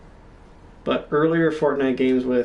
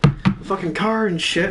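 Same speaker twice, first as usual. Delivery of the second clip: higher-pitched mock drunk complaint; imitating drunken rant; 8 semitones higher than usual. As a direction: act out your drunk self going off. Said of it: "going off on how drunk I was".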